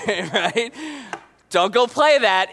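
normal talking voice

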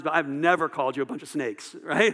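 ordinary delivery

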